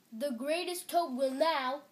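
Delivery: loud showman, cut off; strange announcer voice. A narrator's reading. Voice starting another introduction, loud announcement. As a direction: announce grandly until interrupted. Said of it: "Then the voice said".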